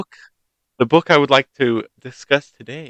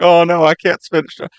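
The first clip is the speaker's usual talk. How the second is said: high, hammed-up wail of despair; mock crying; 8 semitones higher, 4 dB louder than usual. Storyteller voices crying miller's daughter before her task, mock-distressed. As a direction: imitate a helpless girl wailing. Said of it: "And she's crying 'cause she's like".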